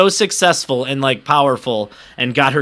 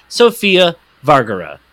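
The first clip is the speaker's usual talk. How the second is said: normal talking voice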